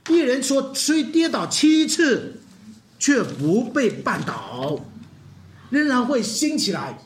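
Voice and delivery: very slow, drawn out proclamation; solemn proclamation. Acted. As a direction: declare each word with weight. Proclaims verse slowly.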